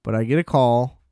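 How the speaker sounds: usual speaking voice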